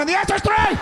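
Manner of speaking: fed-up husband yelling; exasperated shout. Retold or imitated. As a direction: blurt it out in frustration. Arguing over hot dogs.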